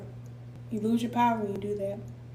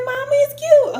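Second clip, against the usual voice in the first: high, louder girlish voice, mimicking her daughter; playing the teen daughter, much higher; daughter's plea; 10 dB louder than usual. Mother imitates daughter pleading, high voice. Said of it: "She said".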